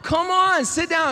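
put-on frustrated children calling to their mother; exasperated kids pleading. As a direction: beg mom to sit down, pitch climbing. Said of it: "We'd be like".

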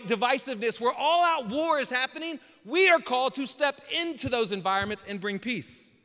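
loud, high preacher cadence building; preaching at full force. Acted. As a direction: preach it out to the congregation.

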